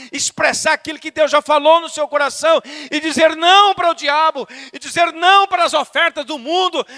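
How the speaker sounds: loud, high-pitched exhortation; full-force preaching. Real, not acted. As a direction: proclaim each refusal forcefully from the pulpit. (pregação inflamada, voz alta)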